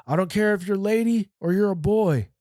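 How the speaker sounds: imitated officer laying down the rules; corrections officer. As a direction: put on a strained, high-pitched officer voice. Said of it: "the corrections officer going".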